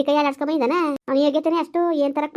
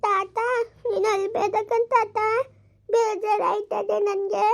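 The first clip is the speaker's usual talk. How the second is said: slow, higher, gentle pleading; pleading comfort; 6 semitones higher, much slower than usual. Acted. Granddaughter begs her crying grandfather to stop, gently pleading. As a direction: coax him to stop crying.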